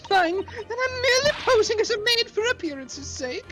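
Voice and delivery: haughty lady voice. Acted. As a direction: play the scandalised lady. Plays haughty lady role.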